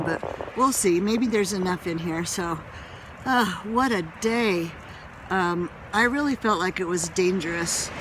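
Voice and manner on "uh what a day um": normal talking voice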